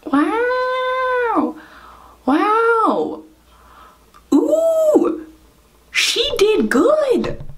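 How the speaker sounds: high, drawn-out impressed wows; amazed. Real, not acted. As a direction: marvel at the result. Admiring the finished look.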